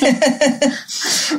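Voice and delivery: short high-pitched laugh. Real, not acted. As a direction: laugh brightly. Guest laughing about her accent.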